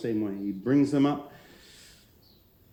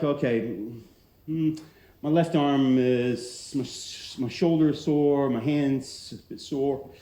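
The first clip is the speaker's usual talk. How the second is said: slow pondering; slower than usual. Retold or imitated. Voicing someone scanning their body.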